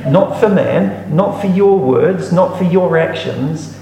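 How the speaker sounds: each "not for" slowed and lifted; emphatic contrast. Real, not acted. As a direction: drive home each "Not for" slowly. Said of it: "preacher contrasting emphatically".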